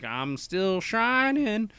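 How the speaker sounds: high, slow sung line that cracks. Retold or imitated. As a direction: let the voice crack on the high note. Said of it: "That was a voice crack right there".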